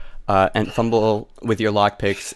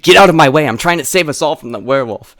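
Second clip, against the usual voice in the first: loud, fast, higher-pitched protest; urgent argument; 5 semitones higher, 7 dB louder, faster than usual. Acted. Luke confronts guard captain over werewolf, loud and urgent. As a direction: push past in a hurry.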